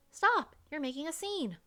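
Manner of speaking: sudden shout. Acted. Emil stopping Lillian at the phones, sharp loud shout. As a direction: yell stop at her before she goes on.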